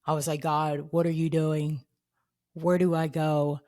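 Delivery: usual speaking voice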